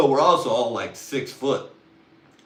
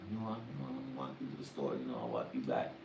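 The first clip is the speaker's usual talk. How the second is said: very soft, low sweet-talk; murmured pickup; 9 semitones lower, 11 dB quieter, faster than usual. Acted. Offering a girl a walk to the store, murmured. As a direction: murmur the offer under the breath.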